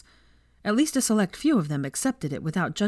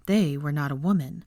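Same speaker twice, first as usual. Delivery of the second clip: ordinary delivery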